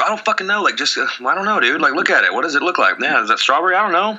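high, pushy customer voice, rushing the server; impatient customer. Acted. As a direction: play a rude customer hurrying the kid. Acting out ice cream shop scene.

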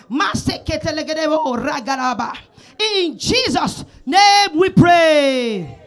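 fervent prayer. Acted. Loud prayer, tongues.

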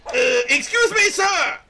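loud, high hail across the road; calling out. Acted. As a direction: call out to get attention. Calling out to a stranger.

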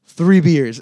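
loud, falling, matter-of-fact; flat statement. Retold or imitated. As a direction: say it like it's settled. Coach repeats order, unbothered.

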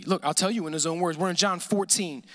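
ordinary talk

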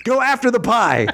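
high, pumped-up fitness ad voice; mock infomercial pitch. Pitching the pie workout to viewers, hyped ad voice.

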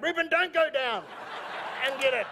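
high, loud comic panic; retold frantic yell. Father stopping Reuben going down in underpants, retold frantic yell. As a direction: yell to stop him, hammed up.

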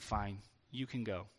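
low, soft and defeated, imitating a crying man; mock tearful resignation. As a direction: give in quietly, lightly acting heartbreak. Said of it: "he's crying, and he's like".

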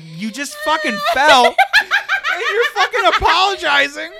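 high, loud, drawn-out disbelief through laughter; hammed-up outraged astonishment; mock incredulous. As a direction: stretch it out, baffled and cracking up. Old man's incredulous reaction, acted.

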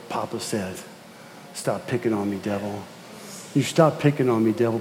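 hushed firm words to the devil; quiet, low rebuke to the devil. Acted. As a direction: speak it low and soft, like a calm warning. Telling the devil, echoing the child.

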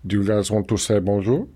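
deep French giant voice; Andre the Giant. Retold or imitated. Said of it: "my only Andre the Giant impression".

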